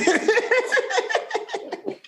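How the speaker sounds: high laugh. Real, not acted. Laughing at his own story.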